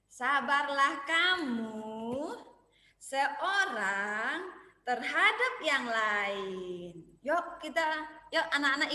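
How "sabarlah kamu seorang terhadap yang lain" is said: each word drawn out very slowly; slow recitation. Shown as a demonstration. Teacher leading children in a Bible verse, very slow. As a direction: recite slowly so kids can follow. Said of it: "ikuti Miss [name]".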